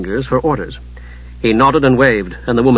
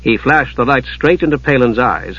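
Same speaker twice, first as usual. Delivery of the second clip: plain reading voice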